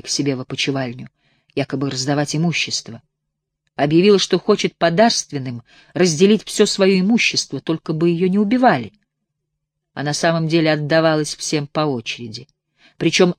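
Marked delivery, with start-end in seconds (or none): none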